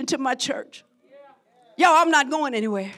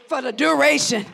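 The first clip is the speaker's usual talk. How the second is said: ordinary talk, nothing marked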